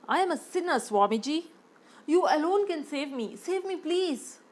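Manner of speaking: a rich man begging the guru to save him; desperate sinner. Acted.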